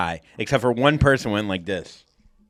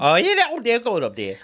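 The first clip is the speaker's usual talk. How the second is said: foul ball voice; 9 semitones higher than usual. Pretend. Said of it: "same voice you use when there's a foul ball".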